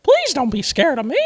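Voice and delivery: hammed-up high-voiced plea; Mickey Mouse. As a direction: plead with mom as a cartoon mouse. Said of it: "talking to her the whole time".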